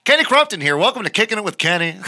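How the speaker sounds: TV anchor voice. Pretend. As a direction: open the broadcast like a local anchor. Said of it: "That's it".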